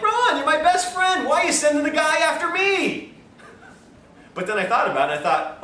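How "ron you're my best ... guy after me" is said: half-laughing mock outrage, much higher pitch; mock betrayed disbelief. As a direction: play the betrayed friend, laughing. Retelling his own shocked reaction.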